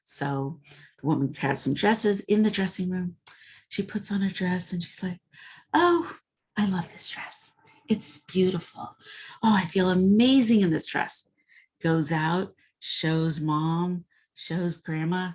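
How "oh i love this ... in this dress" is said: mock delight. Bride trying on the dress.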